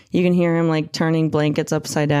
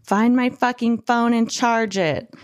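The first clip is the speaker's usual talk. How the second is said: mock screaming demand; 6 semitones higher than usual. Narrator quoting the woman demanding her phone, mock screaming. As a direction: imitate a screamed demand at normal volume. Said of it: "she's sobbing between screaming".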